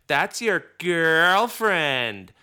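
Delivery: slow, drawn-out teasing singsong; mocking tone. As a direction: tease it slowly like a sneaky five-year-old. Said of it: "mocking tone of voice like that".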